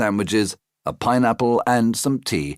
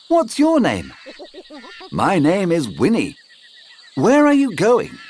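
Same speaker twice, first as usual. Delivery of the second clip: high character voices; 12 semitones higher, 3 dB louder than usual. Acted. Children and Winnie talk.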